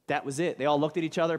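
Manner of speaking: ordinary talk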